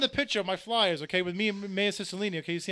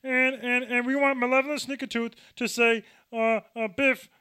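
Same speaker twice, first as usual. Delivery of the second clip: higher, louder Kermit voice; Kermit the Frog impression; 6 semitones higher, 6 dB louder, slower than usual. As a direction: squeeze out a cartoon frog voice. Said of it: "I'll do Kermit the Frog".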